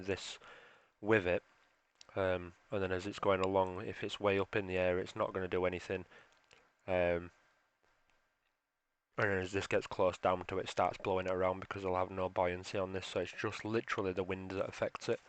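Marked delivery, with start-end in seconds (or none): none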